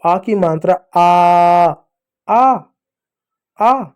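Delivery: आ stretched long, rising like a cry of pain; drawn-out आ. Shown as a demonstration. Teacher shows pupils the आ sound, drawn-out and pained. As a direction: hold आ long, as if hurt. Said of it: "जो पीड़ा के होने पर जो आवाज़ निकलती है".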